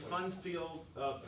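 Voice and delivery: ordinary talk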